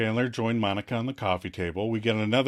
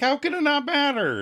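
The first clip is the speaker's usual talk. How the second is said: very high indignant outburst; hammed-up squawk of disbelief; overreacting; 14 semitones higher than usual. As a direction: protest in mock outrage. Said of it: "overreacts a little bit".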